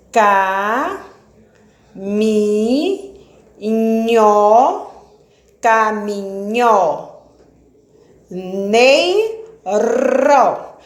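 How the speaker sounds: "Pé, te, ca" sílabas destacadas, com pausas; silabando. Shown as a demonstration. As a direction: bata cada sílaba de peteca. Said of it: "mais de quatro sílabas". (separando sílabas para a turma)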